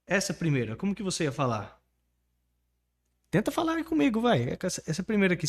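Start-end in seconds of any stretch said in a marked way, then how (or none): none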